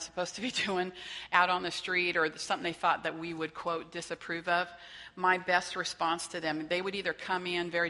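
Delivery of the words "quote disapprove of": ordinary talk, nothing marked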